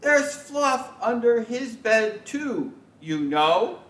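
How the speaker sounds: put-on high, cheeky kid voice; child protesting his brother gets off. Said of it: "So I say".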